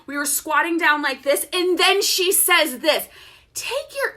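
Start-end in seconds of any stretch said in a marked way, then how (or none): 3.5-4.1 high, soft yoga-teacher hush; whispery instructor tone. Retold or imitated. Yoga teacher instructing the class, in a whispery tone. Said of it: "they freaking talk in this tone".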